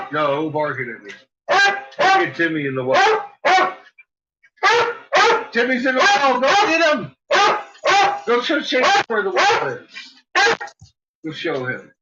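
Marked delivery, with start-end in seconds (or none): 2.0-9.9 much higher, mock urgent urging; high playful dog commands. Real, not acted. 11.3-11.9 quieter, gentle urging; soft dog coaxing. Real, not acted. Softer urging.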